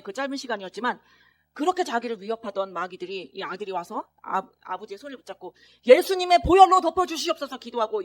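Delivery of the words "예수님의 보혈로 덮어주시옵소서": high, loud praying; loud fervent prayer. Retold or imitated. Son praying over his father's hand, loud fervent prayer. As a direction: pray fervently over him.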